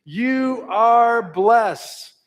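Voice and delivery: high, loud and slow blessing; proclaimed to others. Shown as a demonstration. Pastor leading a blessing spoken over others. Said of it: "say to them".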